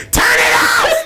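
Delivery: put-on high shouted old lady; high mock yell; grandma yelling. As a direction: yell it like an outraged grandma in the back pew.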